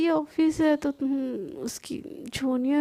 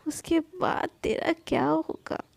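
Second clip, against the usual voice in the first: softer whispered threat; sinister whisper; 4 dB quieter than usual. Acted. Plotting Rohan gloats over Sana, whispering.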